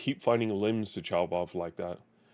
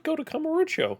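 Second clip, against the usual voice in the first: much higher, mocking singsong; mock high repeat. Mocking the game's order in a high voice. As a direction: parrot it back way up high. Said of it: "I just said".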